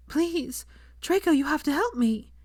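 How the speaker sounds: high, pleading and frantic; desperate plea. A narrator's reading. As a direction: beg for help as if panicking. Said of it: "she cried".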